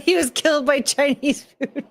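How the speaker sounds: words breaking into laughter; laughing. Real, not acted. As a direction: laugh through the line. Laughing through the punchline.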